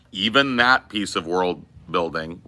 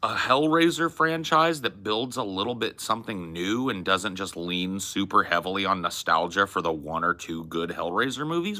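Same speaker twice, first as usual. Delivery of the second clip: ordinary delivery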